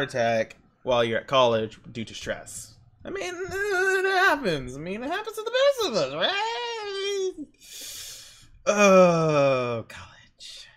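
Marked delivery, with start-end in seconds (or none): 3.0-9.8 high, mock crying lament over college; hammed-up sob about the stress of college; mock wail. A vlogger fake-wailing about college stress. 9.9-10.7 a despairing whisper. Pretend. A vlogger whispering in mock despair about college.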